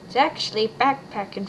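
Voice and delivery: ordinary talk, nothing marked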